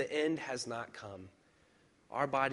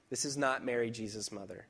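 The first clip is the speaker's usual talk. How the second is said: normal talking voice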